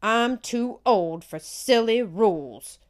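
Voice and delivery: loud, slow indignant huff. A narrator's reading. Aunt Gertie huffing back at the scolding man, loud and slow. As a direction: brush him off, fed up and loud. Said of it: "Aunt Gertie just huffed".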